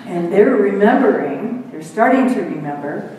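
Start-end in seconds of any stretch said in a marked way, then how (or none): none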